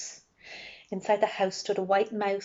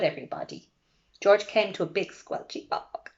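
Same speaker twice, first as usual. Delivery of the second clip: usual speaking voice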